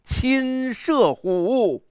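hugely drawn-out declamation, soaring then falling; wild, bold recitation. Shown as a demonstration. Teacher showing the poet boasting like Sun Quan, wild declamation. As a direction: stretch each word, launching high with swagger. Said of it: "你看这个狂".

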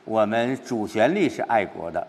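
usual speaking voice

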